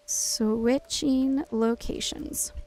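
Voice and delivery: very slow, stretched-out call; drawn-out announcement. Real, not acted. Streamer telling players to change spots, drawn-out announcement. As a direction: announce it slowly, drawing each word out.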